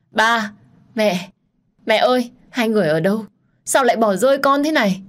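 put-on frightened child calling for her parents, high and loud; crying out loud. A narrator's reading. Lost in a dark dream, the girl cries out for her parents, loud and scared. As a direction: sob and call out for ba and mẹ in the dark. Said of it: "nên bật khóc và gọi lớn".

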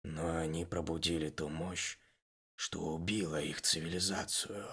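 soft, low, heavy-hearted; heavy sigh. Acted. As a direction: sigh it out in sorrow. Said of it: "тяжело вздохнул Майн". (с тяжёлым вздохом)

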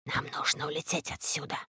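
very quiet, low voice; hushed and low, barely voiced; Шрип. A narrator's reading. Rope tells Карло they must leave, hushed. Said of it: "тихо сказал Шрип".

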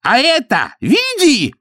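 high, slow, squealing broken Russian; shrill squeal. A narrator's reading. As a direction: squeal it out in hurt anger. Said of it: "взвизгнул он".

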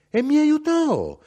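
mother's proud high-pitched cry; triumphant exclaim. Acted. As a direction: crow it like a small victory. Mother recalls triumph over husband.

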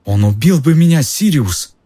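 high, frightened whine; whimpering. A narrator's reading. Pettigrew explains his fear to Sirius, whimpering. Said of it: "захныкал Петтигрю".

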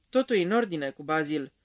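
ordinary delivery, nothing marked

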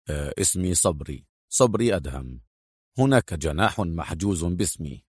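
low, soft masculine character; deep, reserved hotel check-in; composed male guest. Acted. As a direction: introduce yourself in a deep, assured voice. Voices Adham at hotel reception.

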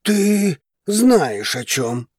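grim, simmering reply; darkly seething. A narrator's reading. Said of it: "мрачно сказал мужчина".

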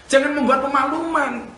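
ordinary talk

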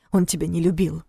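ordinary delivery

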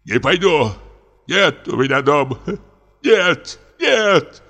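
high, rising plea of refusal; despairing refusal. A narrator's reading. Comic refusing to go home to the prompter, rising high plea. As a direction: refuse like a frightened child.

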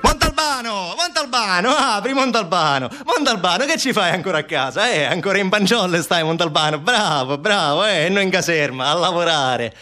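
high, insistent calling at the door; pestering colleague. Acted. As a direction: nag a friend found idle at home. Acts visitor calling at Montalbano's door.

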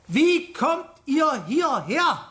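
slow, over-clear question; drawn out for people who can't follow; slow patronising questioning; speaking slowly. Acted. Policeman questions foreigners slowly.

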